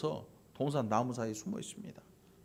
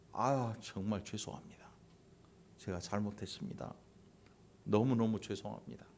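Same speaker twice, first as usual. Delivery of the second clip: soft, lowered, contrite voice; sincere apology; 4 semitones lower, 7 dB quieter than usual. Acted. Someone at fault apologising to the person wronged, acted sincere apology. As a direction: apologise humbly, dropping pitch and volume. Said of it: "진심 어린 사과가 있어야 되고".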